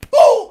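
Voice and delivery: loud, high burst; explosive "boo" of impact; imitated punch noise. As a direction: punch sound effect by mouth. Acts out the punch landing.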